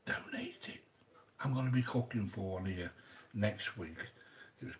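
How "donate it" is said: whispered aside. Real, not acted. Secretive aside to viewers.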